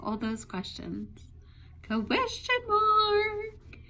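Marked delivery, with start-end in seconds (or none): 1.9-3.8 drawn-out reveal. A narrator's reading. Answer to riddle.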